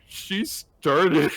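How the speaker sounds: high, whiny imitation of a crier; hammed-up childish protest through tears; mock tearful blaming. Imitating a crying girl blaming her friend, mock whine. Retold crier blames the other.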